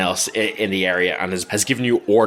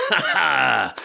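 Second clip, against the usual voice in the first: acted commander's chuckle; in-character "ha ha"; hearty greeting laugh; 6 semitones higher than usual. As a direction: laugh out a welcome to the troops. Zoran greets the party laughing.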